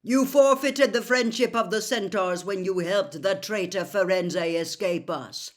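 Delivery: stern centaur and gruff Hagrid; lightly voiced centaur and Hagrid; voiced characters. A narrator's reading. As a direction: read the figures with weight.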